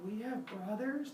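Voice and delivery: high, soft put-on question; puzzled Cyclops. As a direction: ask it small and high, baffled. Cyclops stunned by the news.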